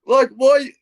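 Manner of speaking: a high, loud cry of disbelief; exasperated why. Real, not acted. Exasperated fan asking the podcast host why, in loud disbelief. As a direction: throw up your hands and ask why.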